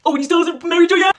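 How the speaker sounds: high, pushed mock-critic voice; mocking taunt. Critic questioning her five-year relationship, mocking.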